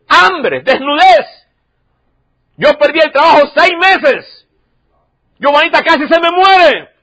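loud, high impassioned sermon; fervent preaching. Acted. Pastor preaching hard to the congregation, fervent and loud. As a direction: preach it out with rising fervor.

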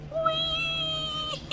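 tiny, high, gleeful whee; playful squeal. Pretend. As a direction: squeal softly like riding a slide. Playful hopping through the game.

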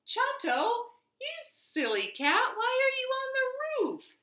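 high scolding mother mouse call. Acted. Mother mouse calling to Chato on the roof, high and chiding. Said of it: "Mommy hollowed".

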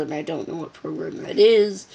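ordinary delivery

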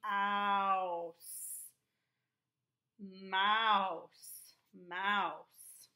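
Mouse called out loud and clear, again and again; loud drilled word. Shown as a demonstration. Teacher calling out the word for pupils to repeat, loud. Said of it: "let's try this one".

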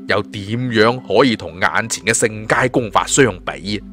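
usual speaking voice

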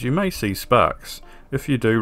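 ordinary talk

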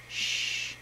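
soft shush to a pet; gentle "shh"; hushing shh. Real, not acted. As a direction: quietly hush the bird. Hushing the singing bird.

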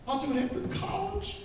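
high, soft put-off voice; mock excuse. A hint of a procrastinator brushing off Jesus.

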